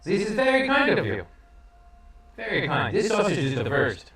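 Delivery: overly grateful; polite adventurer. Acted. Adventurer thanking the twins for the meal, high and gushing.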